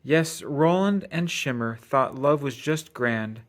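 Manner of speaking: ordinary delivery, nothing marked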